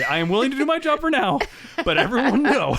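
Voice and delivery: words breaking into laughter; laughing. Real, not acted. Giggling while paraphrasing his character.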